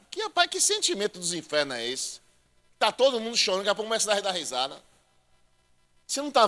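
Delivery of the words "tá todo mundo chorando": usual speaking voice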